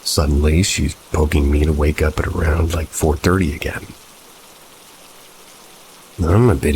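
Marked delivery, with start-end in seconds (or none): none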